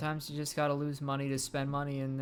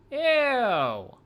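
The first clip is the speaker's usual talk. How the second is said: high, loud shrieked ew; disgusted shriek; 12 semitones higher, 12 dB louder than usual. Pretend. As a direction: squeal it in disgust. Disgusted by cramped space.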